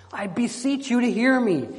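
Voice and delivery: plain reading voice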